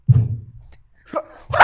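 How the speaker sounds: strangled falsetto whimper of agony; mock agonized falsetto; high-pitched pained cry. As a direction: squeak it out as if winded. Grabbed in the groin, cries out.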